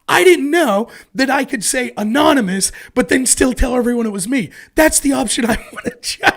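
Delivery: high, loud outraged whine; pissed-off Larry. Retold or imitated. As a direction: play Larry fuming and indignant. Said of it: "And Larry's pissed".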